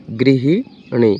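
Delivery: गृहिणी drawn out slowly, every syllable clear; slow spelling demo. Shown as a demonstration. Teacher to students, गृहिणी said very slowly syllable by syllable. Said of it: "ये आपका बिल्कुल शुद्ध शब्द है".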